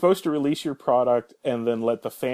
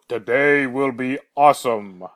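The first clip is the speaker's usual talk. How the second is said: Saunders voice; 3 dB louder, slower than usual. Retold or imitated. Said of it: "Does the Saunders voice ever go".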